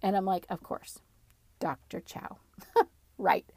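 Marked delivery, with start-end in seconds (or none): 0.5-2.4 deadpan sarcasm. Retold or imitated. Sarcastic whisper to spirit. 2.8-3.5 a burst of laughter; laughing. Real, not acted. Laughs at own story.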